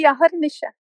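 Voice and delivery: ordinary talk, nothing marked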